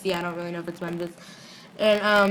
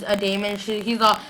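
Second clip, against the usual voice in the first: usual speaking voice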